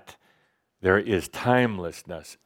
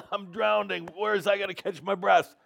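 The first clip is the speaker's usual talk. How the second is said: high, loud cry of someone drowning; sudden alarm, pitched way up; panicked scream; 11 semitones higher, 4 dB louder than usual. Acted. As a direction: yell it like you're going under. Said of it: "that scream, like I just did".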